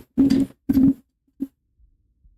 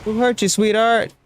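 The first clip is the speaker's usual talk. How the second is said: rising, slow mock-tender whoa; mock soothing; 5 semitones higher than usual. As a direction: tease her like calming a child. Teasing an angry game character.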